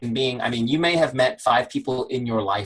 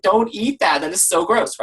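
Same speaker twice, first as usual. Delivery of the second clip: higher, louder mock disgust; grossed-out reaction; 7 semitones higher, 5 dB louder than usual. Coach reacting to vending machine junk food, grossed-out. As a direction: protest in a higher voice, appalled.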